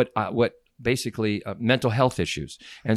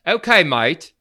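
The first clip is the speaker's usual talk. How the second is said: British attempt that slips into Australian; higher, louder mock-Australian accent; Australian-sounding accent; 7 semitones higher, 5 dB louder, slower than usual. Shown as a demonstration. Guest asked for fake British lands on Australian, botched accent. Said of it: "No, that sounds Australian".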